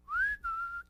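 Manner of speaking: a high, quick whistle into the mic; kid's high whistle; imitated whistle. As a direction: whistle a little noise to get on air. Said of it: "and he doesn't whistle".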